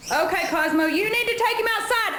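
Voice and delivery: high, fast, loud urging; panicked urging. Real, not acted. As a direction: rush the dog out in panic. Bird loose, urging dog.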